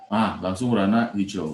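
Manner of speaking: normal talking voice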